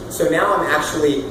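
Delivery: ordinary delivery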